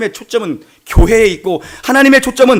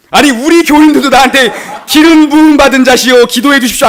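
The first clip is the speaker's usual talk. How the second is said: loud, higher, mock-indignant outburst; comic astonishment; 5 semitones higher, 7 dB louder than usual. Pastor saying even his congregation never calls him that, astonished. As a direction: blurt out the grand title in amazement. Astonished at beggar's grand address.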